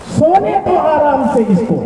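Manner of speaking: soaring poetic delivery; verse recitation. Acted. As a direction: call the verse out high to the listeners. Verse recited, first take.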